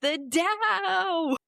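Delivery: mock shrill cry. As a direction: squeal it desperately. Mocking Bondi shouting about the Dow.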